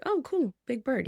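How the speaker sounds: breezy high mock-casual Ryan impression; casual boyfriend. Imitating her boyfriend brushing off the big bird, breezy and high. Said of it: "being all casual on the phone".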